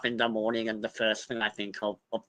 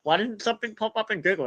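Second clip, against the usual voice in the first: much higher, fast, mock frustrated nerd voice; nerdy brother; 11 semitones higher, faster than usual. Streamer mocks her brother when Google finds nothing, high and fast. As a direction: rattle it off high like a baffled know-it-all. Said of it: "And he'd be like".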